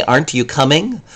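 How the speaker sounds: "Aren't you coming?" rising, assuming they will come; expecting yes. Shown as a demonstration. Teacher showing a negative question that expects yes. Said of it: "the implication is, I think you are".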